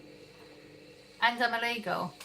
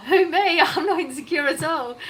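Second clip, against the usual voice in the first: laughing it off, high and loud; 6 semitones higher, 8 dB louder than usual. Real, not acted. Laughing off a chat insult, loud and high. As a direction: laugh through the denial.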